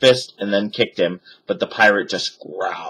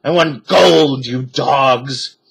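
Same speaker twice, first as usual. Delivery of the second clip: pirate captain; 4 semitones higher, 4 dB louder, slower than usual. A narrator's reading. Captain demands gold.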